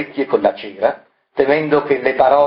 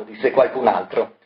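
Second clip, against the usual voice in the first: ordinary delivery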